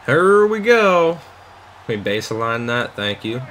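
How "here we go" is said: higher, slow, stretched hype call; drawn-out hype. Real, not acted. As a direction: stretch it out, pumped up. Hype before kickoff.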